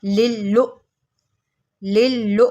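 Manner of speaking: लु with a sharp catch on the hamza; jerked hamza. Shown as a demonstration. Teacher showing students the hamza jerk, sharp catch. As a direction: give लिल लु a quick jolt. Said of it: "इसको झटका दे के पढ़ते हैं।".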